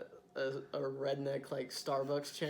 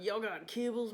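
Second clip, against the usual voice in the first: higher hammed-up Southern twang; 7 semitones higher than usual. Playing redneck customer at counter.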